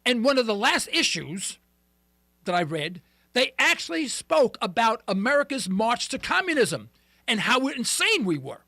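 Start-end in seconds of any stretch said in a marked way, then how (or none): none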